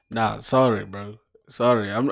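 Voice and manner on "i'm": ordinary delivery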